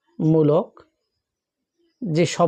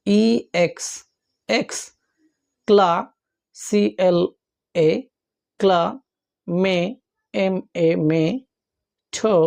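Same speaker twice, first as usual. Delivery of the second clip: letters and syllables spoken apart with long pauses; x, ক্লা, মে, টো, রি each said alone with a pause. Shown as a demonstration. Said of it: "তাহলে এরকম বলতে হয়".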